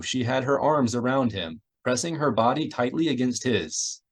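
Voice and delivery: ordinary delivery, nothing marked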